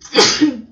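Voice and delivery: a real sudden sneeze; loud sneeze out of nowhere; sneezing. Real, not acted. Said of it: "eu vou espirrar".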